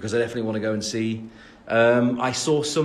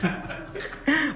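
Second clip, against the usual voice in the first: high laugh; 3 dB quieter than usual. Real, not acted. Laughing at his own joke.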